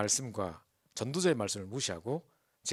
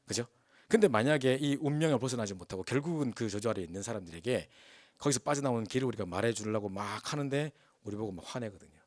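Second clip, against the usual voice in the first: ordinary talk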